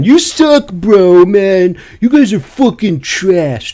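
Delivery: high, loud mocking whine of a toxic player; whiny toxic gamer. Mimicking a toxic teammate in Apex, high whiny taunt. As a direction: sneer it like a sore loser gamer.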